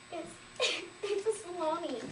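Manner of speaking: words broken up by acted laughter; laughing. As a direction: barely get it out through giggles. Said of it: "Finally, I started laughing".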